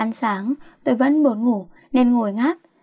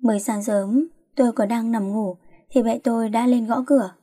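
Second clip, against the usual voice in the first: ordinary delivery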